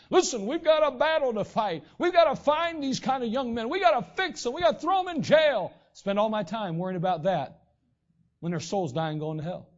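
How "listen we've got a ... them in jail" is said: higher, louder, rallying the crowd; hammed-up call to arms, higher and louder; mock crusading rabble-rouser. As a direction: whip up a crowd like a zealous crusader. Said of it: "I start saying".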